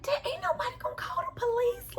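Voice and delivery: high-pitched disbelieving squeal; high mock outrage. Watching the scene, mock shocked high squeal.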